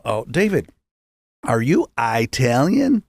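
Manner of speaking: Italian said "EYE-talian", drawn out slowly; retold teacher saying Italian as "EYE-TAL-yun"; imitating Southern pronunciation. Teacher questions a new pupil, Italian drawn out as "EYE-talian". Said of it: "they say Italian down there".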